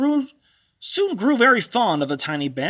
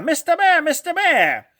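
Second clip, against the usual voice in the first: high, loud screech of a call; screechy calling voice; 8 semitones higher, 4 dB louder than usual. A narrator's reading. Witch calling out for the bear in the night, screechy high call. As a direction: screech it out across the creek. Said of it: "a screechy voice split the air".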